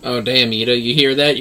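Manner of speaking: ordinary talk, nothing marked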